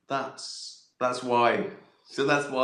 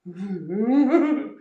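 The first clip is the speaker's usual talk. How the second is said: a big laugh, higher and louder; 10 semitones higher, 6 dB louder than usual. Real, not acted. He cracks up at his own detective bit.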